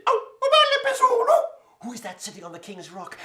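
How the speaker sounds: very high, loud squeaky rabbit cry; a startled little rabbit calling out high; rabbit's voice. Acted. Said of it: "and he said".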